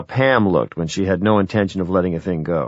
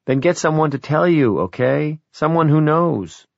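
higher-pitched daughter pressing her point; insistent, raised-pitch urging; 6 semitones higher than usual. Acted. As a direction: push Dad to get an expert opinion. Daughter presses her reluctant father.

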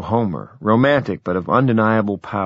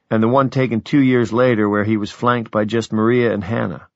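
usual speaking voice

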